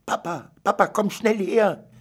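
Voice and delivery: high, loud, eager calling; excited call. Acted. Girl calling her father across the animal shelter, excited. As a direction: call Papa over in a rush of excitement. Daughter calls father to cage.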